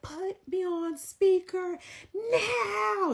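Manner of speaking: high, loud, very drawn-out yell. Retold or imitated. Man demanding speakerphone, furious mock yelling. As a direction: imitate him yelling, stretching NOW right out. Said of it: "This is what he yelling on the phone".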